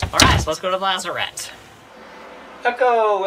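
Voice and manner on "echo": high loud call into a space. Pretend. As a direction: call out to hear the echo. Calling into the empty locker.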